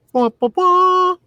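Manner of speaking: high, drawn-out "wah wah wah"; slow high vocal stinger; mock music stinger. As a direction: sing out a fanfare with your mouth. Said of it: "needs its own music stinger".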